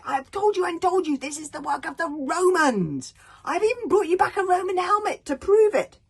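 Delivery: high, loud indignant demand; furious son. Acted. Hiccup furiously confronting his father. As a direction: demand it angrily. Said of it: "he said furiously".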